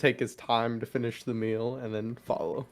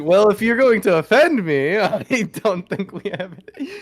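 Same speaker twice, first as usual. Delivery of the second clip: laughing deadpan; 6 semitones higher, 7 dB louder than usual. Acted. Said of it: "He's like".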